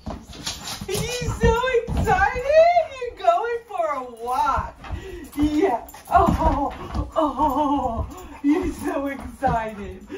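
high, slow sing-song pet talk, loud at first; dog baby talk. Acted. Owner coos to her eager dog before the walk, sing-song baby talk.

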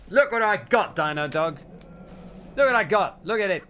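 loud, high crowing over a find; gleeful crowing. Real, not acted. Gamer showing Dino Dog his loot, crowing loudly. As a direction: crow about the loot to the dog.

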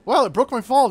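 normal talking voice

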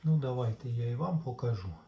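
usual speaking voice

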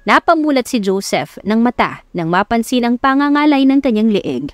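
usual speaking voice

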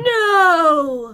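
loud, drawn-out no; dismayed wail. Real, not acted. Player seeing the course is gone, dismayed wail.

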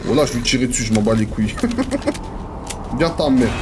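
normal talking voice

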